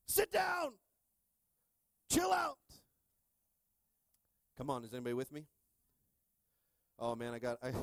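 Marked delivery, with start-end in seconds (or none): 0.1-2.6 high, loud shout of a frazzled parent; mock exasperated parent. Parent in a restaurant shouting at a running child. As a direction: yell at the kid to sit down. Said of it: "and you're going".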